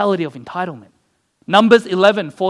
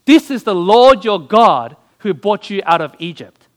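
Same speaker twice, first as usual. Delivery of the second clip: "This" hit hard, the whole line slowed and louder; "This" stressed loud and slow, each word weighty; emphasized declaration; 7 dB louder than usual. Shown as a demonstration. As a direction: lean on "This is the Lord your God" and drag it out. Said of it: "Why does he have to emphasize".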